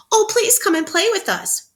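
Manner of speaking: high, loud pleading voice; eager little monkeys begging. Acted. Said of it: "begged the monkeys".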